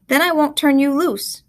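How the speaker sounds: woman's country dialect. Acted. Woman refuses to release the boy, country dialect. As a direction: refuse him flatly in dialect. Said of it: "with the dialogue in the dialect".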